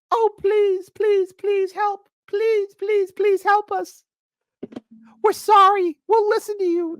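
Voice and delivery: high, whiny mock pleading; mock begging liberals. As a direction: beg pitifully in a falsetto. Mocking liberals begging him.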